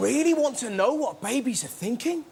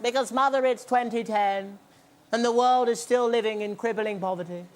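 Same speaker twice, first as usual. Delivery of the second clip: put-on profound baby translator. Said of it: "What if they're surprisingly profound?".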